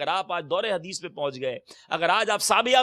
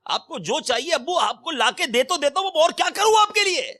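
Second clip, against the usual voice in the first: rising, higher and louder complaint; exasperated son; 9 semitones higher, 3 dB louder than usual. Retold or imitated. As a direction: vent frustration at the old father. Grown son complaining to father.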